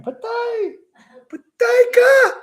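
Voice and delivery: very high mock yell building to a loud, stretched finish; high-pitched retold shout, last words drawn out; imitated yelling. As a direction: mimic a man yelling threats, pitch soaring. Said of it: "when the guy is yelling".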